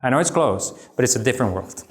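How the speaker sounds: ordinary delivery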